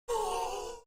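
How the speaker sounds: a high, sharp gasp of amazement; mock sharp gasp. Streamer reliving his account shooting up, high gasp. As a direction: gasp as if seeing the numbers jump again. Recalling watching the account jump.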